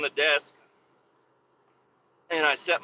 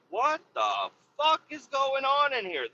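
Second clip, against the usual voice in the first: high-pitched mock outrage; retold indignant reaction to the mess; exasperated disbelief; 9 semitones higher, 3 dB quieter than usual. Retold reaction to dirty floor.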